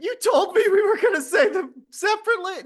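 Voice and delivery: laughing protest. Real, not acted.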